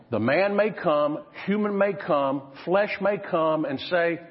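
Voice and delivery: normal talking voice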